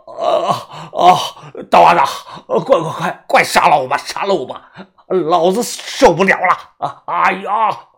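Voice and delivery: loud, broken cries of pain; agonized yelling. Acted. Sick old man begging his follower to kill him, agonized yelling. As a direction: groan and yell, begging desperately. Patient in agony begs to die.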